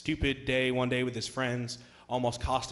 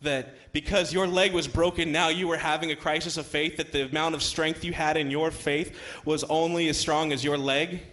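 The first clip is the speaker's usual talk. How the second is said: louder, driving sermon delivery; emphatic preaching; 4 semitones higher, 6 dB louder than usual. Acted. Preacher drives home that faith was only as strong, emphatically. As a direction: preach the point home forcefully.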